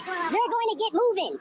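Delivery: drawn out, word by word; slow, emphatic order. Real, not acted. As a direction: spell out the order very slowly. Repeats order, spaced out.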